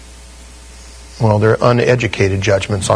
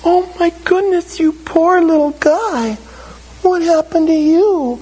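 put-on much higher sympathetic coo to a crying toddler; cooing at a child. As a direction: fuss over a little kid like you'd give him a cookie. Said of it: "I say".

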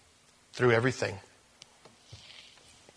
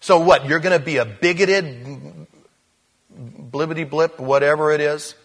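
mocking classmate; 3 semitones higher, 6 dB louder than usual. School friends mock a new believer, loud and jeering. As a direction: jeer at the friend who found faith. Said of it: "and they go".

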